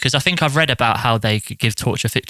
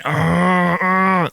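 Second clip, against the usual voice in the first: mock pained groan; groaning through the jaw; retold groan of pain; 7 semitones higher than usual. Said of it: "I was just going".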